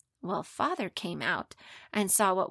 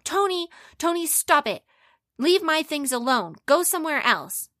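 loud, higher-pitched angry yell; boy yelling at his little brother; 7 semitones higher, 8 dB louder than usual. Acted. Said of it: "he started yelling at him".

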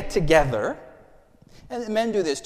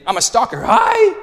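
mock stalker shout, high and loud; hammed-up creepy greeting; 11 dB louder than usual. Shown as a demonstration. Pastor hamming a stalker's texted greeting, loud mock shout. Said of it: "But it could have been".

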